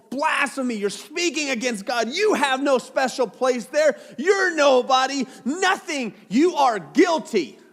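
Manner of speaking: loud, high, furious priest denouncing a blasphemer; outraged accusation. Acted. High priest condemns Jesus, furious loud accusation. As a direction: hurl the charge at him, climbing in pitch and volume.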